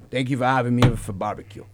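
put-on low, soft old-dad voice; imitated father. Voicing father at the barbecue.

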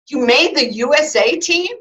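high, loud, astonished question; amazed question. Retold or imitated. Doctor J seeing the medal, amazed and loud. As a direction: ask it in stunned disbelief.

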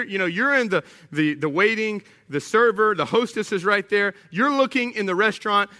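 ordinary talk

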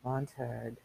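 very soft, low whisper. A narrator's reading. Faber secretly warning Montag in his ear, whispered. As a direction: murmur the name very quietly. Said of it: "that's Faber, a whisper".

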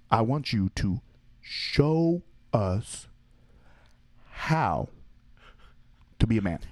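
very slow, spaced-out mock dare. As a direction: drag each word out deliberately. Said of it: "And then he would slow it down".

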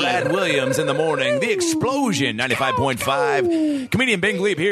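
hyped morning radio host. Pretend. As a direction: announce it like a morning radio show. Mock radio show intro.

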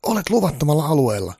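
high-pitched, loud security guard. Acted. Guard telling Santa he has no gun licence, high and loud.

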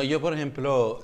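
ordinary talk

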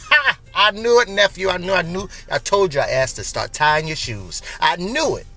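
loud, high ha, settling into told-you-so; mock gloating. Disliking relative gloating at fallen nephew, mock gloating. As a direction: crow over them, hammed up.

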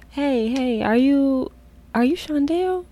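put-on eager hail to an old classmate; excited call. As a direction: call to him, hopeful and rising.

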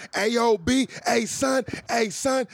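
high, loud New York street call; hammed-up New Yorker hailing someone; New York accent. Shown as a demonstration. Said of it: "that's like a New York accent".

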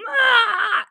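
put-on a sudden high, loud wail of a ten-year-old; retold scream. Boy shrieking at mom about the locked class, crying wail. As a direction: imitate the boy's upset shriek, half-crying. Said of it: "like, screaming and freaking out".

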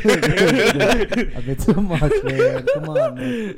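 laughing loudly through the words; cracking up mid-protest. Real, not acted. Laughing at a crude remark.

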